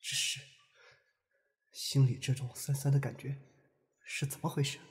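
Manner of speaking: soft, hesitant thinking aloud; quiet musing. Acted. Watching the couple together, feeling sour, quiet musing. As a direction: murmur it to yourself, with pauses.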